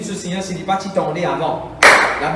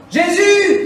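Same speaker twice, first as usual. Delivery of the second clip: calling out; 11 semitones higher, 7 dB louder than usual. Acted. Preacher calls out Jesus.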